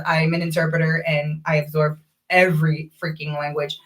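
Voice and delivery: ordinary talk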